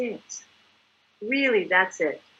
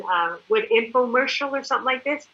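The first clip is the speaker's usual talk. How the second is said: ordinary talk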